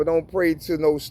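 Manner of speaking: ordinary talk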